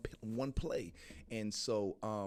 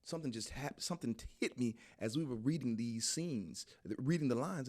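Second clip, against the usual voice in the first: ordinary talk, nothing marked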